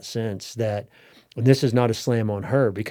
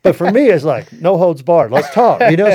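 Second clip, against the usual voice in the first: excited; 7 semitones higher, 12 dB louder than usual. Real, not acted. Excited telling of bathroom story.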